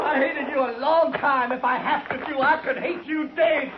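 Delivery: loud, high, hate-filled yelling with a laugh breaking through; yelling at Quince, hate spilling into laughter; furious shout. Acted. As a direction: bellow your hatred down the hill. Patchen taunts his old enemy.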